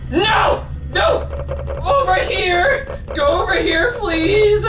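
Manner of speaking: high, tearful pleading; panicked screaming. Real, not acted. Player pleading with the creature, panicked high cry. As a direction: beg in a rising panic. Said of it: "I need to stop screaming".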